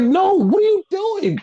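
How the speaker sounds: very high-pitched imitated panic; mock freak-out, voice shooting way up. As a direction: squeal it in alarmed disbelief. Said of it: "freaking out".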